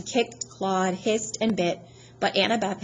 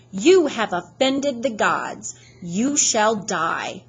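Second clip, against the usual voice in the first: slow, louder Southern threat; southern accent; 4 dB louder, much slower than usual. Acted. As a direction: pronounce doom in a Southern drawl. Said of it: "in an accent that was definitely from somewhere farther south than Georgia".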